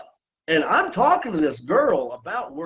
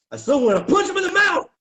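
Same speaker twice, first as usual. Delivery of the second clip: higher, louder and quick; real flare of anger; fast angry shout; 11 semitones higher, 3 dB louder, faster than usual. Real, not acted. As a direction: spit out the urge to hit him. Renewed anger at Ellsworth.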